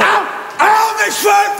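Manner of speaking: high pained yelp; crying out. Acted. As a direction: yelp as hair is pulled. Hair pulled out, crying out.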